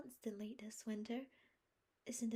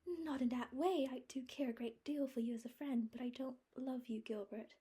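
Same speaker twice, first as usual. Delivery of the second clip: higher, louder troubled voice; distressed refusal; 6 semitones higher, 4 dB louder than usual. A narrator's reading. Anne telling Gilbert she loves him only as a friend, distressed. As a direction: refuse gently but firmly.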